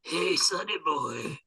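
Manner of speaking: lightly voiced old man, slow and coaxing; old man beckoning. Kid calling Chuck over, a light old man voice. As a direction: call him over like a creepy grandpa. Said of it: "a twelve-year-old going".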